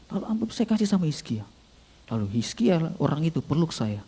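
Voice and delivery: usual speaking voice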